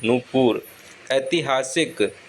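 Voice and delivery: usual speaking voice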